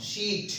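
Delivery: शीट held on a long ee; long vowel. Shown as a demonstration. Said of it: "मैं इसको थोड़ा सा long कर रहा हूँ". (शीट लंबी आवाज़ में)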